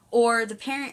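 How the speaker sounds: ordinary delivery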